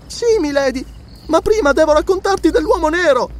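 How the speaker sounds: put-on very high child's voice; little girl. A narrator's reading. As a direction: answer milady as an earnest little girl. Little girl answers her lady.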